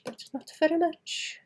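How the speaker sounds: showing off a slight Welsh accent; put-on Welsh. Shown as a demonstration. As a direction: lilt it a bit Welsh, playfully. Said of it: "I can make myself sound like I'm a little bit Welsh".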